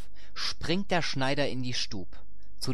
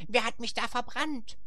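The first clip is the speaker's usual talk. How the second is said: very high, small hare voice; little hare crying out; 13 semitones higher than usual. A narrator's reading. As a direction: squeal it as a little storybook hare. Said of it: "Er schrie".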